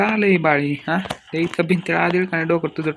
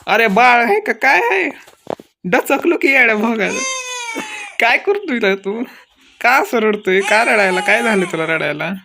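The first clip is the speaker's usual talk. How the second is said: frantic crying plea; 6 semitones higher, 3 dB louder than usual. Acted. The baby is crying, she panics.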